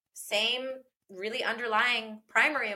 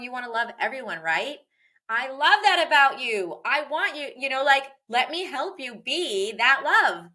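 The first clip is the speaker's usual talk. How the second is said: put-on louder, eager coaching of a child; encouraging parent; 4 semitones higher, 6 dB louder than usual. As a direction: cheer the child on toward love.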